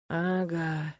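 drawn-out, pensive murmur of assent; deeply thoughtful. A narrator's reading. Nolan agrees to divide the bed, mock-profound. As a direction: utter it as if pondering deeply. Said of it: "глубокомысленно изрёк Нолан".

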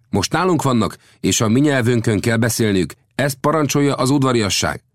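high, insistent chief's voice; proud young chief. Acted. Chief insists on his own language, high and firm. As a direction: lay down the rule, pitched high.

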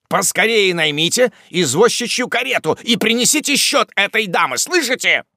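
peremptory order. A narrator's reading. Wardle sends a servant for a carriage, peremptory order. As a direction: order the servant about impatiently.

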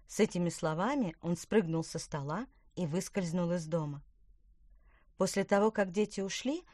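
plain reading voice

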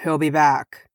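mildly harsh warning; grim, understated harshness. A narrator's reading. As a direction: say it with a hard edge. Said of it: "Dain said harshly".

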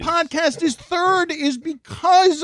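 high-pitched exasperated retort; retold arguing. Arguing with Greg late last night over the podcast, exasperated. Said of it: "I was in an argument".

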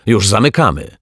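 loud, high, menacing announcement; threatening. A narrator's reading. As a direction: snap it like a warning. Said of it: "groźnie potrząsnęła mopem".

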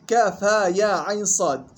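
slow recitation of the letters; tajweed recitation demo. Shown as a demonstration. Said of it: "فيه وجهان".

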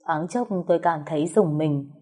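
ordinary delivery, nothing marked

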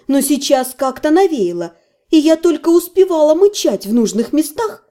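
ordinary delivery

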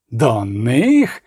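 incredulous question swooping up from low; understated outburst, stretched in disbelief; explosive outrage. A narrator's reading. Voloshek balking at orders to join the army, outraged. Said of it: "вибухнув Волошик".

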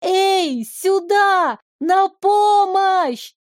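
high desperate cry for help; calling for help. Acted. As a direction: shout for help, high and drawn out. Heroine calls for help.

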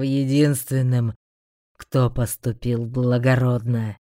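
low, slow commanding demon; a demon pushing him to die. A narrator's reading. Demon urges sacrifice. (медленный властный голос демона)

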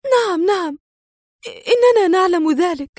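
high, confused assent; flustered high character voice. A narrator's reading. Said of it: "وهي تغمغم في حيرة".

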